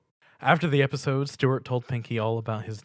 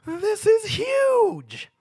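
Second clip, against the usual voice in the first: very high, loud bubbly pony voice; Pinkie Pie; 8 dB louder, much slower than usual. A narrator's reading. Pinkie Pie greeting Stuart with a loud high squeal. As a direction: squeal the welcome like an overexcited pony. Pinkie sees gingerbread house.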